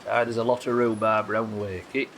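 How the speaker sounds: broad South Yorkshire vowels, rhubarb said roobaab; thick South Yorkshire accent, a bit overdone. Pretend. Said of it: "That's a South Yorkshire accent".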